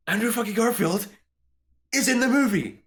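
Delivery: high, excited disbelief. Retold or imitated. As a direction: gush like a stunned fan. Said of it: "I was like".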